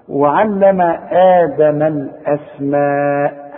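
slow chant with the last aa of "الأسماء" held long; four-count madd. Shown as a demonstration. Teacher models the madd, "الأسماء" slowly drawn out. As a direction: stretch "الأسماء" on a level note. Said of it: "تمدها أربع حركات".

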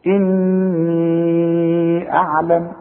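sustained level note on "إني", then a plain "أعلم"; long madd before hamza. Shown as a demonstration. Teacher demonstrates joining the words, long-held "إني" into "أعلم". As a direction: chant "إني" very slowly, holding the final ي before "أعلم". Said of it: "مد أربع حركات".

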